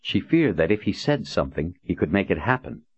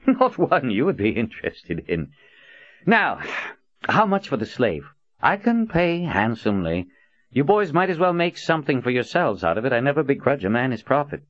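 high, breezy and amused; lightly voiced charming old man; jovial Prophet voice; 8 semitones higher than usual.

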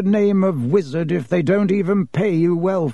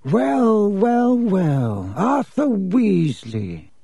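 high, loud, very slow drawl; sneering greeting; 6 semitones higher, 5 dB louder, much slower than usual. A narrator's reading. Mister Malfoy greeting an enemy in the shop, sneering. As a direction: stretch each word with haughty relish. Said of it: "sneering in just the same way".

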